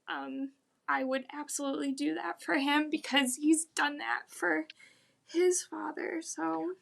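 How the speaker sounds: teary, high and strained voice; crying. Real, not acted. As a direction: still crying, trying to keep talking. Crying about her dad.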